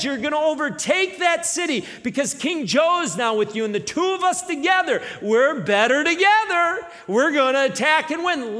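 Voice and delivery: loud, high insistent pitch; preaching shout voicing logic; emphatic shout. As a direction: push the argument hard and loud.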